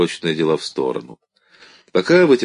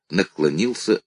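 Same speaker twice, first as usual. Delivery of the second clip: ordinary delivery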